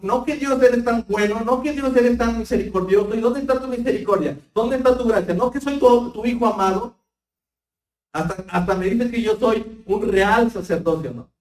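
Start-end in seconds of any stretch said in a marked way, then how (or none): 0.0-10.1 high, louder whiny imitation of a disappointed believer; mock complaint to God. Preacher imitates a believer complaining to God, mock whiny. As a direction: voice the frustrated believer's grumbling, half-mocking.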